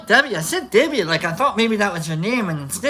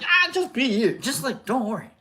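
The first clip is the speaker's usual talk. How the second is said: mocking platitude; 3 semitones higher, 4 dB quieter than usual. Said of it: "not like in that bullshit".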